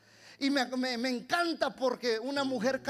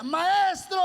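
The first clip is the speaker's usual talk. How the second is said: high, drawn-out shout; 7 semitones higher, 5 dB louder than usual. Acted. Bartimeo calling to Jesus through the crowd, shouting. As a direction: shout it out to make him turn. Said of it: "cuando él le grita".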